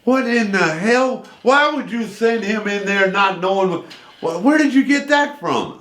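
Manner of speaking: put-on high-pitched, indignant old lady; outraged old lady. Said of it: "The lady looks and says".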